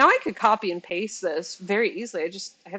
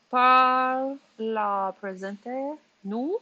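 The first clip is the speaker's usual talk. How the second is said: slow French read with a Spanish lilt; careful French with a Spanish tinge; Spanish-sounding French; 4 semitones higher, 4 dB louder, much slower than usual. Real, not acted. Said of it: "if my sounds a little bit Spanish".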